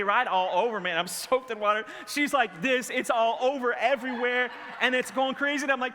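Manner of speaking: ordinary talk, nothing marked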